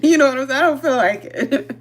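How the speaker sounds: laughing while saying it; words breaking into real laughter; giggly, half-laughing. Real, not acted. Laughing through her own point.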